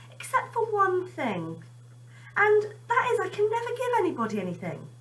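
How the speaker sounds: put-on high, earnest child's voice; little boy Benny. Benny tells his mother what he can't give. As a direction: lift the voice into a small wistful boy.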